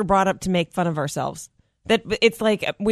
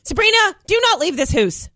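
high, scolding Canadian vowels; raised-pitch Canadian mother voice; Canadian accent; 7 semitones higher, 4 dB louder than usual. Acted. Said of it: "I got busted immediately".

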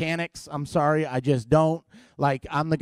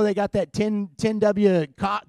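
usual speaking voice

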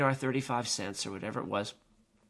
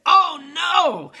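high, loud cry of dismay; horrified shout; 10 dB louder than usual. Retold or imitated. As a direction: cry out as your friend falls. Climbers see their friend fall.

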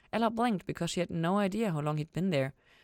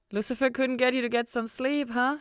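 Ella voice; 9 semitones higher, 4 dB louder than usual. A narrator's reading.

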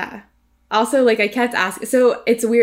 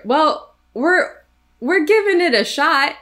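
higher-pitched, hedging mock reassurance; imitating doubtful paramedic; 8 semitones higher, 4 dB louder, slower than usual. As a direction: play an unconvincing medic, stalling hesitantly. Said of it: "You don't wanna hear".

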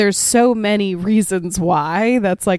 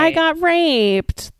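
high, drawn-out put-on complaint; mock sister voice; 10 semitones higher, much slower than usual. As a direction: imitate the joke's sister, slow and high. Voicing sister in the retold joke.

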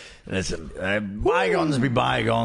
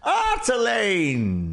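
exasperated call; 11 semitones higher, much slower than usual. Acted. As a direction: scold him by calling his name. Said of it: "scolding a companion".

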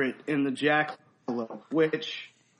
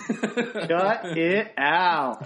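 slow, drawn-out catchphrase with a laugh; lightly voiced Dave Coulier bit; Dave Coulier impression; much slower than usual. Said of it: "practicing Dave Coulier impressions".

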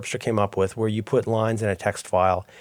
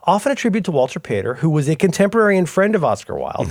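higher, eager assistant voice; chatbot answer; 7 semitones higher, 4 dB louder than usual. Retold or imitated. ChatGPT answering the Walter Pater question, in a higher, eager voice. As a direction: voice the bot's reply a step higher and louder. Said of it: "And it goes".

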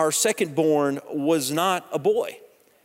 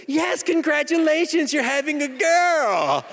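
bright, much higher cheerful nurse voice; ultrasound lady; 9 semitones higher than usual. Retold or imitated. Ultrasound lady announces a girl to the stunned father, cheerfully high. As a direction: chirp the good news like a smiling technician. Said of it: "ultrasound lady said".

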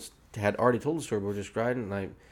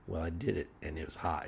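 normal talking voice

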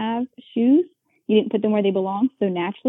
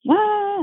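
high mock wah of a child told no; mock toddler wail; 13 semitones higher, 4 dB louder than usual. Mimicking a child freaking out over a snack refused, high wah. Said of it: "freaking out because I got told no".

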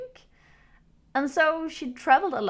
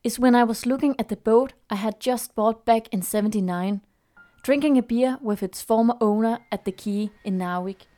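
story narration. A narrator's reading. Reads the story over music.

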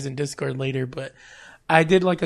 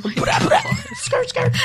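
much higher, shouted mock ad-libs; mock rapper ad-libs shouted through laughter; laughing rapper ad-libs; 3 dB louder, much slower than usual. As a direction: yelp the ad-libs while laughing.

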